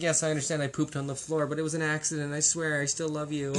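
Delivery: a guilty puppy, fast at first, laughing through the end; playful dog pleading, laughter breaking in. Pretend. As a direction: beg forgiveness as a sheepish dog. Voicing an apologetic dog.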